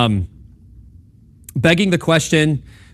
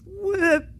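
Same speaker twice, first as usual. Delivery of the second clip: mock shock; 7 dB quieter than usual. Acting shocked at a question.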